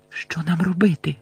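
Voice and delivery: hushed, anxious question; trembling fear. A narrator's reading. Hermione asking Harry what to do, hushed and fearful. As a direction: ask timidly under the breath. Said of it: "трусячись усім тілом".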